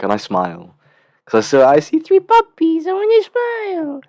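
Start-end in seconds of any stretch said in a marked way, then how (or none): 1.7-4.0 very high, squealed cutesy voice; gushing squeaky voice. Acted. Student seeing three puppies on a walk, gushing squeak.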